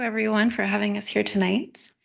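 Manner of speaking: usual speaking voice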